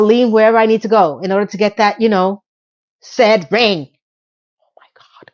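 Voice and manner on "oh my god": unvoiced whispered oh my god. Real, not acted. Host whispers in disbelief at the story, voiceless whisper. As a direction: breathe it out, voiceless. Exasperated aside.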